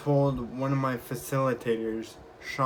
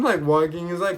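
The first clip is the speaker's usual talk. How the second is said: normal talking voice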